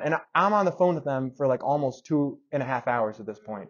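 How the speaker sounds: ordinary delivery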